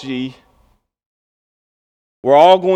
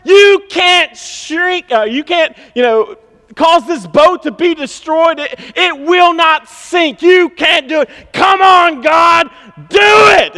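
much higher, loud shouting defiance at the sky; Lieutenant Dan on the mast defying God, shouting; crying out to God; 12 semitones higher, 6 dB louder than usual. Retold or imitated. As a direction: roar it into the storm, daring God. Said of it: "he's crying out to God".

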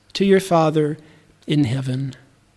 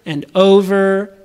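drawn-out repetition; slower than usual. Shown as a demonstration. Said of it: "repeating yourself over".

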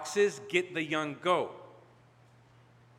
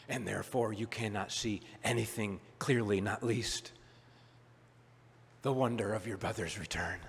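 much softer and lower, grieving; sorrowful father; 7 semitones lower, 9 dB quieter than usual. Retold or imitated. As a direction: let it fall into quiet lament. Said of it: "It's as if the father is saying".

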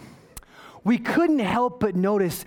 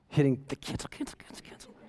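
whispered, sheepish confession; embarrassed whisper; 7 semitones lower, 8 dB quieter, faster than usual. Real, not acted. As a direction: hide the words in a whisper. Recalling his meltdown, embarrassed.

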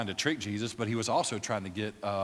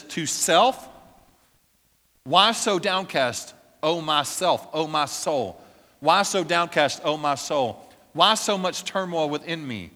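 confronting his own soul; 4 semitones higher, 8 dB louder than usual. Retold or imitated. David confronting his own downcast soul, loud pleading. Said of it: "confronts himself and says".

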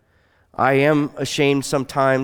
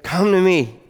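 a raised, ringing invitation; ringing call; 5 semitones higher than usual. Acted. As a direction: lift Come to me like a call across the crowd. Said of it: "you hear the voice of Jesus say".

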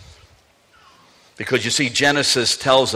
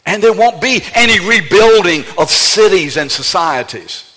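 forceful warning; 6 semitones higher, 5 dB louder than usual. Real, not acted. Preacher warns with full force.

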